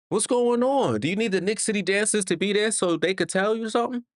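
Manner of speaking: high, fast exasperated rant; mock the player in disbelief. Real, not acted. Fan ranting at Bridges, high and fast in disbelief.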